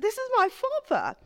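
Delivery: high, wondering exclamation; amazed recognition. Retold or imitated. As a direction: gasp it out in disbelief. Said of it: "I said".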